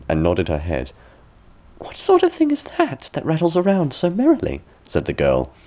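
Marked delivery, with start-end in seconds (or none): 1.8-4.6 put-on very high, delighted girlish voice; wondering princess. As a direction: marvel at the rattling spindle like a girl. Princess marvels at spindle.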